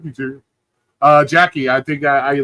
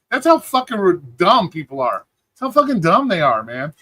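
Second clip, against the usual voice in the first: ordinary delivery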